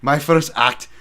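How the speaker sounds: put-on ranting Scottish accent as Willie, loud; Scottish Willie shout. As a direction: roar it like a mad Scottish groundskeeper. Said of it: "Oh, Willie".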